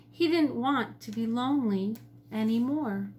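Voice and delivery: ordinary delivery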